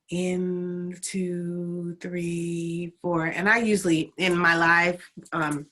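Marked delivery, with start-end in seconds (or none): 0.1-3.2 unhurried, drawn-out breath count; slower count. Shown as a demonstration. Teacher showing the count at its proper slow pace. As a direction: stretch each number like the first time. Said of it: "It goes kind of like how I said it first".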